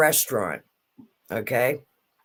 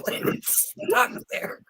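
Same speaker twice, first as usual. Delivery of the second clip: whispered laughter; much slower than usual. Real, not acted. Laughing at the stuck roll.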